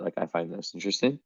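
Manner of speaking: ordinary talk, nothing marked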